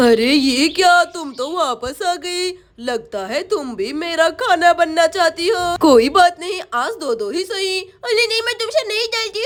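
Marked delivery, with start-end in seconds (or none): 0.1-7.8 low, menacing witch gloating over her meal. Acted. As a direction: growl it like a hungry witch. Witch voice in kids' story. (डरावनी चुड़ैल की धीमी आवाज़)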